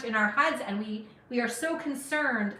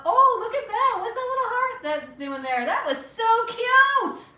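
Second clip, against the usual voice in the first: much higher and louder, gushing over a heart; delighted squeal; 12 semitones higher, 7 dB louder than usual. Real, not acted. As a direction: coo at the little heart in real delight. Viewer sends a heart on live.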